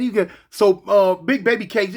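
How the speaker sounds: ordinary talk, nothing marked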